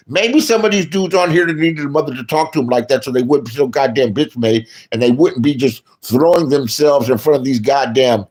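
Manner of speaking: usual speaking voice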